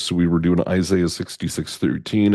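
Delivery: normal talking voice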